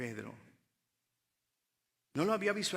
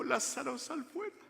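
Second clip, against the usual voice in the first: calling Lazarus out; 10 semitones higher than usual. Acted. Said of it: "cuando dijo".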